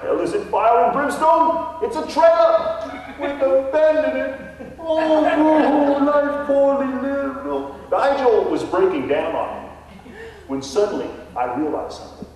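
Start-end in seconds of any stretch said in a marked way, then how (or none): 0.0-7.8 wailing, much higher and louder fake English lament; mock English accent. Ghost Nigel wails to the realtor in a fake English accent. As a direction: ham up a despairing English ghost. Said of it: "a Texan trying to emulate an Englishman".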